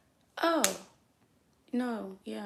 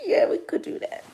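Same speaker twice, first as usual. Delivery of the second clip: much higher, breathy whisper; 13 semitones higher than usual. Retold or imitated. Dancer whispering yes to the customer's extra fifteen minutes. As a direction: whisper it sweetly. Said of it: "I'm like".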